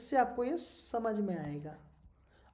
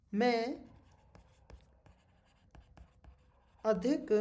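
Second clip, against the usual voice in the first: slow dictation with long pauses between words; slower than usual. Acted. Teacher dictating a definition to students, slow word-by-word dictation. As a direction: dictate it piece by piece, drawing out words.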